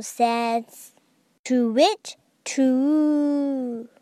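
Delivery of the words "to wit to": breathy whispered hoot; whispered owl call. Acted.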